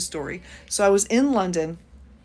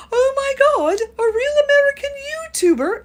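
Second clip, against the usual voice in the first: very high, loud mock English squeal; hammed-up English teenage boys losing it with excitement; horrible English accent; 8 dB louder than usual. Teenage boys bouncing in London airport, horrible English accent. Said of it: "bring out a horrible English accent".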